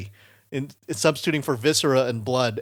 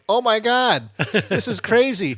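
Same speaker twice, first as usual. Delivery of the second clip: high-pitched mock awe; imitated amazement; 9 semitones higher, 4 dB louder than usual. Partygoers marvel at the cardboard maze, high imitated amazement. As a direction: play a crowd gawping, pitched up. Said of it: "gathered around the living room going".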